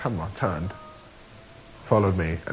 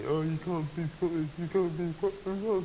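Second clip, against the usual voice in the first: softer mock taunt, imitating a teaser; mocking imitation; 3 semitones higher, 7 dB quieter than usual. Imitating David teasing him about his accent, mocking. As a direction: mimic someone taking the mickey, quietly. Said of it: "he takes the mickey out of me".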